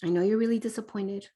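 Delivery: soft, gentle parent voice; calm reassuring. Shown as a demonstration. Parent resetting a sassy child, soft and calm. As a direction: talk it down quietly and kindly. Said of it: "and we say".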